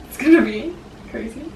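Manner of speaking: excited. Real, not acted. Excited about moving in.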